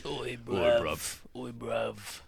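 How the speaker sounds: mock British accent. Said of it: "Definitely be British".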